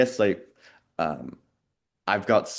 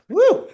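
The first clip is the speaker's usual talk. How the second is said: high, loud "woo"; sudden high-pitched whoop of unease; shuddering whoop; 8 dB louder than usual. Real, not acted. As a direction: let out a sharp, high woo. Reacting to disturbing part of book.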